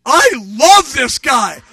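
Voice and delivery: high, loud, drawn-out delight; gushing admiration. Retold or imitated. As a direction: shout your love for your new hero, slowly. Gushing over the new pastor.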